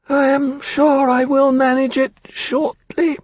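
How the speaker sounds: very high, slow frail hatchling; small, pale voice. A narrator's reading. Newborn dragon answers Temeraire in a small, pale voice. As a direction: pipe it faintly and haltingly, high-pitched. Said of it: "said in its small, pale voice".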